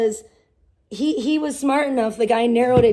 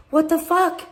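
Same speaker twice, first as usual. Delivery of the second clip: higher, louder jolt of shock; startled exclamation; 6 semitones higher, 4 dB louder than usual. Retold or imitated. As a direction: burst out like you just saw something wrong. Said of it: "And I'm like".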